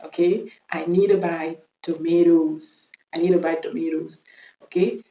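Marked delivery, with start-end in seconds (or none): 0.2-2.9 very slow American "tomatoes", to-MAY-does; American accent. Shown as a demonstration. Teacher drilling the plural slowly, American accent. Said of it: "l'accent américain". 3.1-4.2 American "tomatoes" with an "ay" middle; American accent. Shown as a demonstration. Teacher repeating the plural, American accent. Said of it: "l'accent américain".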